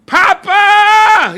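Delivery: full-throated high scream across the tarmac. Retold or imitated. Father on the observation deck screaming to his arriving dad. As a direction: yell over the roar of propellers. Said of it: "He's screaming".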